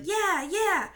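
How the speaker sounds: loud, high team cheer; yelling. Acted. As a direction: cheer back at full voice. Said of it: "we started yelling".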